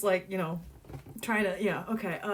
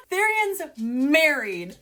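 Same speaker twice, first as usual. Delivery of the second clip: louder, slow, delighted repeat; excited; 6 semitones higher, 6 dB louder, slower than usual. Real, not acted. Reader thrilled that Therian is married. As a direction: savor the news out loud.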